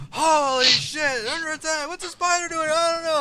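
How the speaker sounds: high, loud panicked shouting; panicked guards. Acted. Guards panicking at the spider attack, high frantic shout. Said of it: "Everybody's like".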